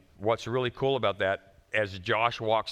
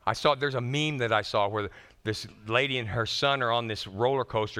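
usual speaking voice

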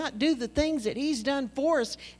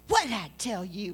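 put-on talking down to a kid. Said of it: "He was so into it".

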